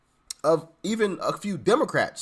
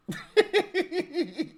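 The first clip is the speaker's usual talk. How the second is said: high laugh; 12 semitones higher than usual. Real, not acted. Host laughing.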